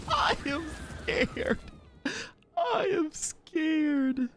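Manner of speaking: high, slow drawn-out whimper; mock terrified. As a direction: stretch it out in pretend fear. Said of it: "I am terrified".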